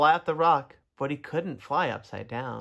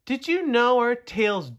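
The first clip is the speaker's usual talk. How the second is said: calling out; 6 semitones higher, 5 dB louder, slower than usual. Acted. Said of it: "he asked".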